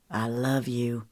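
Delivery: low and soft, lovingly; whisper-soft love line; tender male voice. A narrator's reading.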